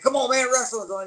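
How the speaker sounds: high, calling a friend over; a lifted shout through the door; eager call. Retold or imitated. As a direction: urge your buddy to come watch. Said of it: "I'd be like".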